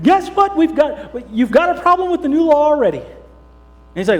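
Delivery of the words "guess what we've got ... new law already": high, loud breathless report; eager tattling spies. Acted. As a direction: blurt out the news.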